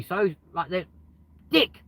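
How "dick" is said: loud, high blurt; shouted out. Real, not acted. Blurting a word out the open window, loud and high. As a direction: yell the word out suddenly.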